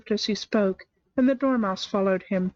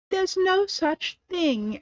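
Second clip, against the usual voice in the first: angry; 10 semitones higher, much slower than usual. A narrator's reading. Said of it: "beginning very angrily".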